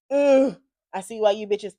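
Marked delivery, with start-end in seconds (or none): 0.1-0.6 exaggerated "ugh"; high drawn-out groan. Real, not acted. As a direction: let out a squealed groan. Groan over men in jail.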